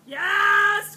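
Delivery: loud burst of praise; excited yes. Real, not acted. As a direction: cheer the dog's success. Dog got it right.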